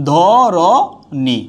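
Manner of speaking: ধরণী stretched slowly, syllable by syllable; slow demo pronunciation. Shown as a demonstration. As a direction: draw ধরণী out slowly for students. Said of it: "teacher demonstrates pronunciation".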